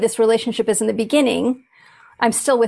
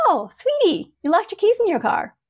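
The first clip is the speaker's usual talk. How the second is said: sweet inner voice; 7 semitones higher than usual. Retold or imitated. Imitating her kind inner voice after locking the keys in the car, high and sweet. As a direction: coo it gently, much higher than your own voice. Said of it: "this little voice goes".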